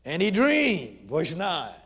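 loud, slow scripture preaching. Acted. Preacher proclaiming Joseph's dream, loud and slow.

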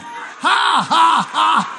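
loud, high preacher's "ha ha"; deliberate laugh. Shown as a demonstration. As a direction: belt out each ha on purpose. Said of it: "Y'all don't wanna laugh".

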